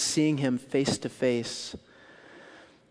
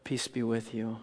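softer, calm and peaceful; gentle Jesus voice; 3 semitones lower, 6 dB quieter than usual. Acted. Jesus greeting frightened disciples, soft and calm. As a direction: speak peace quietly into the room. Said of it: "And you hear the voice".

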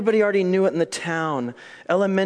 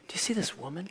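hushed, derisive question; mock derision; 2 semitones higher, 11 dB quieter, faster than usual. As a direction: show how it would sound with contempt, softly. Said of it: "He doesn't say it with derision".

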